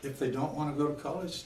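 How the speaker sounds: ordinary delivery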